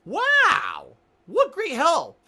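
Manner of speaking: high, loud mock "wow, what great help"; sarcastic. Mocking the unhelpful desk clerk, sarcastic and loud.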